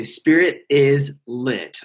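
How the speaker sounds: "Spirit is lit" said slowly and loud; slow emphatic title. Shown as a demonstration. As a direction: drag out "Spirit is lit" word by word. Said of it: "So just say".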